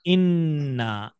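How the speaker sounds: इन्ना slow, with the nun stretched; slow, lean on the doubled nun of इन्ना; held nun. Shown as a demonstration. Teacher to children, drawing out the doubled nun. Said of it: "उसी नून को खींच देंगे आगे".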